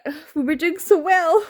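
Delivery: high, plaintive pretend whine; mock wail. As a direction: lament it with comic despair. Mock lament over the year's reading.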